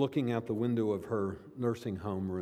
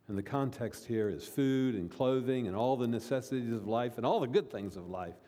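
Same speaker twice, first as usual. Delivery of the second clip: usual speaking voice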